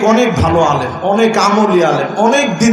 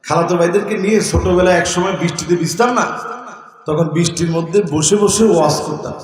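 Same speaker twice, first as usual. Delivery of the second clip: usual speaking voice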